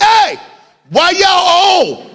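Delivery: put-on high, loud childish yell up to the front seat; kid yelling. Boy yelling to his parents in the front seat, loud. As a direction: bellow it like an eight-year-old with no filter.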